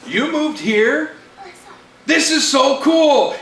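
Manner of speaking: slow, high, drawn-out amazement; excited disbelief. Acted. Friend echoes the reunited boy, excited and amazed. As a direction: echo it back like a thrilled kid.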